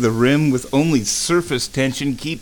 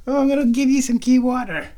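high, quick cooing; 11 semitones higher, 3 dB louder, faster than usual. Real, not acted. Owner promising her cat water in high baby talk. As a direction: chirp at the kitty.

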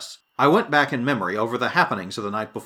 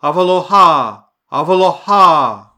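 loud, higher-pitched victorious call; triumphant shout; 5 semitones higher, 10 dB louder than usual. A narrator's reading. Echoing through the rock from afar, triumphant shouting. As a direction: shout it out long and exultant. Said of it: "a triumphant shouting".

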